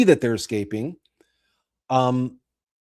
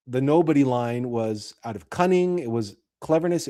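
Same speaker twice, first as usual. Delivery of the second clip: ordinary talk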